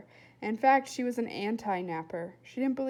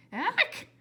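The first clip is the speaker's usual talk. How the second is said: high, louder startled yelp; 10 semitones higher, 6 dB louder than usual. A narrator's reading. William startled as the squirrel flies past, loud yelp. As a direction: yelp as something whizzes by.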